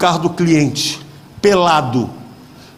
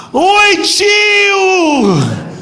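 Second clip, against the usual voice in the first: shrill-free high squeal of a cheerful greeting, much higher; high camp greeting; 11 semitones higher than usual. Retold or imitated. Travestis greeting the pastor's car with a high camp hello.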